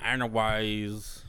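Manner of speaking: slow drawled Texas "Anyways"; country talk. Shown as a demonstration. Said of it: "like, country talk".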